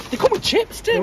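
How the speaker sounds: very high-pitched delighted surprise; real excitement. Real, not acted. Food reviewer finds chips came with the order, real high-pitched excitement. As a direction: squeal it with excitement. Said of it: "Hear the excitement in my voice".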